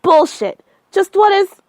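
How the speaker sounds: loud, high furious cut-in; enraged outburst; angry shout. Acted. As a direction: bark it back in rage. Angry interruption of the caller.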